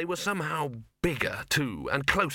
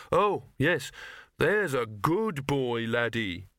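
dutiful dog praise; much slower than usual. A narrator's reading. Victor dutifully praises the waiting dog.

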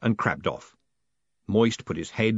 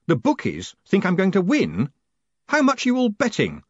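high, raised, appalled questioning; shouted disbelief; 10 semitones higher, 5 dB louder than usual. A narrator's reading. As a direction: demand an answer, aghast. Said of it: "he shouted".

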